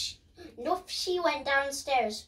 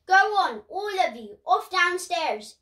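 put-on shooing the kids; 6 semitones higher, 5 dB louder than usual. Mum shooing the children off.

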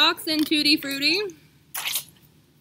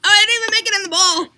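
excited; 6 semitones higher, 9 dB louder, faster than usual. Real, not acted. Spilling, reacting loudly.

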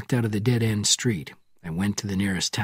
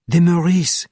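loud and high into the phone; shouting; 8 semitones higher, 8 dB louder than usual. A narrator's reading. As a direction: shout it down the line. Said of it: "she shouted into the phone".

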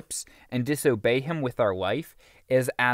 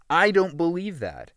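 raised and higher, a mild mock scream; retold scream; 5 semitones higher, 3 dB louder than usual. Woman refusing counsel on her divorce, a half-hearted mock scream.

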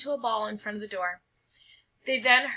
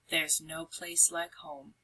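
soft, slow relieved echo; contented; 5 semitones lower, 8 dB quieter, slower than usual. A narrator's reading. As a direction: let it settle softly. Narrator echoes the sigh.

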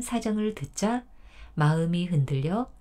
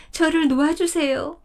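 higher and louder, pushing 저를 up in desperation; urgent plea; 7 semitones higher, 4 dB louder than usual. Acted. 덫에 걸린 사슴이 할아버지에게 더 간절히 애원하듯. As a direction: beg harder, voice climbing on 저를. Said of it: "repeated plea, raised".

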